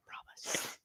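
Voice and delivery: hushed whisper to the camera. Real, not acted. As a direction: whisper it as a secret.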